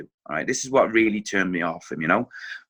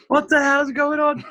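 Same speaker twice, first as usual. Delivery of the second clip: incredulous squeak; 5 dB louder than usual. Retold or imitated. Disbelief at bungled chip.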